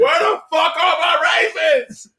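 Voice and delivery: loud, slow angry shout with a put-on voice. Angry redneck at barbecue.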